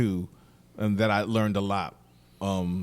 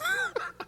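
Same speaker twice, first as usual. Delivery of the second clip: high laugh after a punch line; amused laugh; 6 dB quieter than usual. Real, not acted. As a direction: laugh at your own story.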